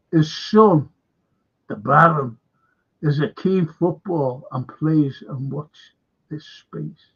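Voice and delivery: ordinary talk, nothing marked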